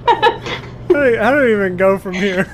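high shouting through laughter; laughing. Real, not acted. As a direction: laugh out loud while talking. Group breaks into laughter.

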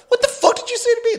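very high, fast mock outrage; outraged challenge. Man in line told to shut his mouth, mock outrage. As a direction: challenge him in high-pitched disbelief.

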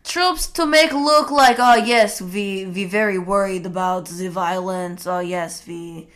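lightly put-on Russian accent; mock Russian official voice. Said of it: "only in a Russian accent".

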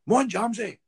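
loud, high mock cheer; rowdy shout of encouragement, imitated; imitated heckle. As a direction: call out like a lad egging someone on. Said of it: "one of the guys was like".

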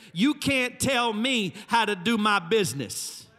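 loud, high preacher's call; full-throated sermon delivery; preaching. Acted. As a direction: drive each question home from the pulpit. Preacher's rising call to listeners.

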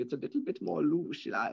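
hammed-up German accent. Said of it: "I can also do my, my, my German accent".

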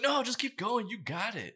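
encouraging friend. Retold or imitated. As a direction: cheer them up the wall. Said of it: "My friend was like".

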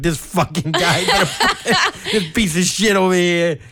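high, loud griping through laughter; mock hating. Fan back to hating the seat neighbour, laughing loud gripe. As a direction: rail about him, half laughing. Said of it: "went right back to hating him".

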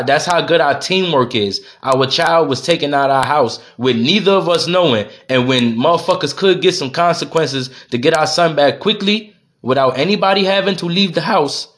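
normal talking voice

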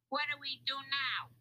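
high-pitched crook voice; bewildered crook. A narrator's reading. Lefty asks Louis for a plan, bewildered crook. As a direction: ask helplessly in a high crook's voice.

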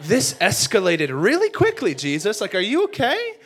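loud, incredulous questioning climbing high; alarmed Peter. Acted. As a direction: react as if blindsided, hammed-up. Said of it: "can you imagine Peter in that moment".

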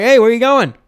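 loud, high call after someone. Retold or imitated. Calling to the lady as she gets up to leave, loud. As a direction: shout across to stop her leaving.

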